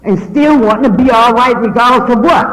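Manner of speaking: lightly preached appeal to the church; preaching. Acted. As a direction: lean into it and preach it. Preaching testimony to congregation.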